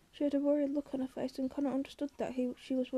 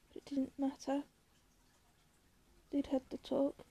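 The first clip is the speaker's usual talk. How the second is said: plain reading voice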